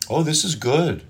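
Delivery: high, gushing compliment; overdone fake praise. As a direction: sell it too hard. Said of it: "they know you're full of shit".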